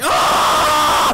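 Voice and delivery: long, high-pitched scream; mock scream. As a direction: imitate a YouTuber screaming mid-video. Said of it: "or I keep screaming".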